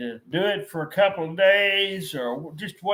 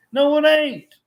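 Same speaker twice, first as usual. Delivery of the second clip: higher, louder, flatly shutting it down; firm rebuttal; 10 semitones higher, 7 dB louder than usual. Real, not acted. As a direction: cut the critic off hard. Rebutting the imagined critic.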